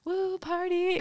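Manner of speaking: high mock whoop; cortisol partying. Her stress hormones celebrating, high mock whoop. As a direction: cheer like a party guest, lightly. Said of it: "My cortisol is like".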